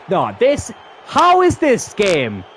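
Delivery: high, loud spluttering disbelief; real incredulous fury, breaking off mid-question; outraged shouting. Real, not acted. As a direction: shout slowly, too outraged to finish. Spluttering at second goal conceded.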